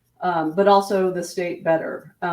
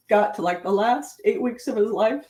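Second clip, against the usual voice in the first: ordinary talk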